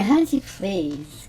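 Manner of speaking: slow, drawn-out hunty; hunty stretched slow and dismissive; passive-aggressive sass. Retold or imitated. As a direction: drawl it with attitude. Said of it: "She would say".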